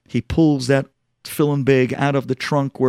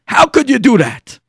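loud, high accusation; angry reproach; 10 semitones higher, 6 dB louder than usual. Acted. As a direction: burst out at Dad in outrage. Son confronts father, raises his voice.

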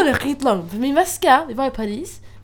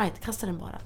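retold thought; 5 semitones lower, 13 dB quieter, faster than usual. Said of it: "Jag tänkte, jag bara".

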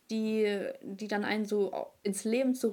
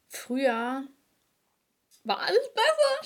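hohe, laute Spottstimme für früher war alles besser; früher war alles besser übertrieben hoch und laut als Witz; spöttischer Nostalgiker; 6 semitones higher, 6 dB louder than usual. Pretend. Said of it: "Spaß". (hoch und laut ironisch nachgeäfft)

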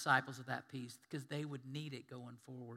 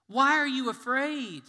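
loud, high, slow question; Jesus challenging his disciples, loud and slow; loud rebuke; 9 semitones higher, 15 dB louder, slower than usual. Retold or imitated. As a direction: call them out firmly.

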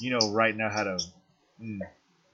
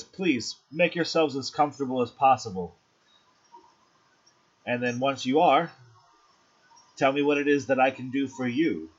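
slightly higher, inviting hostess voice; gracious hostess; 4 semitones higher than usual. Acted. Hostess Rosé inviting newly arrived guests to get comfortable, gracious. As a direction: welcome guests with lilting, lightly raised tones.

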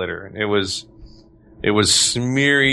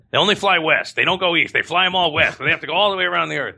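joking riff; 6 semitones higher than usual. Pretend. Joking riff on airline's name.

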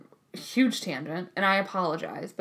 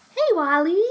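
calling the dog; 8 semitones higher, 5 dB louder, slower than usual. Real, not acted.

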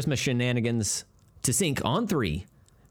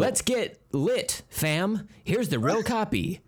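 pitched way up, slow, hammed-up teen slang; mock teen slang voice; 9 semitones higher, slower than usual. Host mocking how kids talk, hammed-up high slang voice. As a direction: squeal it like a try-hard teenager. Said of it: "however you guys talk".

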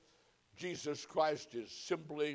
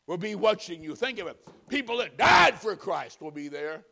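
loud, high, urgent pulpit delivery; fervent warning sermon, punching key words; full-force preaching; 4 semitones higher, 6 dB louder than usual. Real, not acted. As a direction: drive each phrase home to the congregation.

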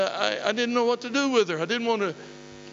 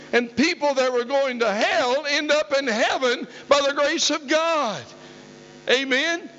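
higher, ringing sermon climax; rousing preaching; 4 semitones higher than usual. Real, not acted. Preacher building the testimony to its climax, rousing preaching. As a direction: drive it home to the congregation, stretching key words.

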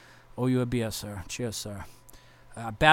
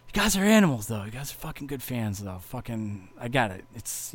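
ordinary delivery

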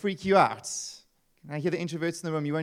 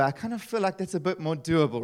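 ordinary talk, nothing marked

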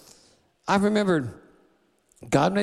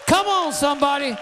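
rising, shouted preacher cadence; a pastor rallying the church against the devil, preaching hard; full-force preaching; 7 semitones higher than usual. Acted.

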